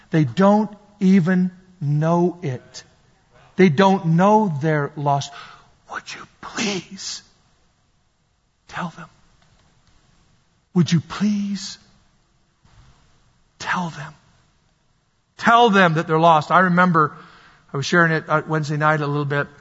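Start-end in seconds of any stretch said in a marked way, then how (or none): 5.9-14.1 very soft, slow pleading; slow, breath-held entreaty; hushed plea. Real, not acted. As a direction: beg them in near-whisper, with long pauses. Preacher pleads with congregation.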